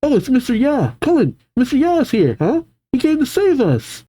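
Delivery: put-on high-pitched excited child voice; squeaky kids spotting their rescuer. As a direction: pipe up like a thrilled little kid. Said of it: "The other kids say".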